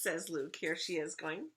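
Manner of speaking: plain reading voice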